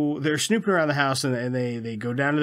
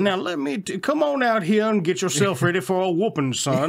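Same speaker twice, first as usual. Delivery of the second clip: put-on Southern accent; 6 semitones higher than usual. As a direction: play a Southern gentleman calling out. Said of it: "That's a good impression".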